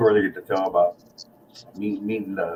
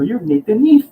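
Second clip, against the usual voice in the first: excited recognition; 12 semitones higher, 6 dB louder than usual. Retold or imitated. Recognising the famous bassist.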